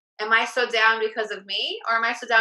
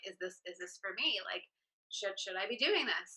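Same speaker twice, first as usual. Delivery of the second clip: quiet worried doubt; 11 dB quieter than usual. Retold or imitated. Acting anxious self-doubt.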